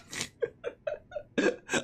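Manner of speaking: squeaky unvoiced laughter; breathy high laugh. Real, not acted. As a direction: laugh through frustration. Laughs after mock rage.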